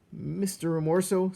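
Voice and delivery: high, loud anxious call; small mouse voice. Acted. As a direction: call out nervously. Despereaux calls the rat.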